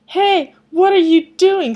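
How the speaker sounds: put-on high, loud startled kid yell. Ciel yelling as his brother grabs him, loud and high. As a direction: shout in protest. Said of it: "Ciel yelled".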